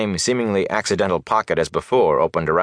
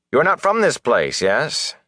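higher-pitched, cool interrogation; cold questioning; 7 semitones higher than usual. A narrator's reading. Bornhold questioning Rand, coldly. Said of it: "he said coldly to Rand".

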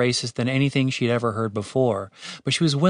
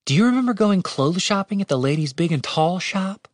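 higher-pitched voice for a questioning female character; Catherine, puzzled questioner; 7 semitones higher than usual. Acted. Woman asking her companion about clothes shopping, higher character voice. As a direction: lift the voice into the heroine's register.

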